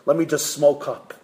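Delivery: ordinary talk, nothing marked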